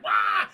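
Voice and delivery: loud mock gargoyle roar; short, blaring attack cry; imitated monster cry. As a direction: burst out with a quick loud "wah".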